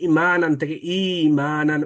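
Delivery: ইমানান slow, the ই drawn long; hamza and madd contrast. Shown as a demonstration. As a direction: say ইমানান both ways slowly. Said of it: "অথবা আমরা বলি". (ধীরে ইমানান দুইভাবে)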